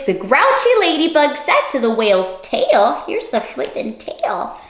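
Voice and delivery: usual speaking voice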